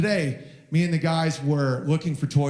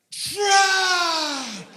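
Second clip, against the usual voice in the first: much higher, long drawn-out shriek of joy; ecstatic toddler shout; 14 semitones higher than usual. Retold or imitated. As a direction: scream it like the best thing ever. Son's joyful shout.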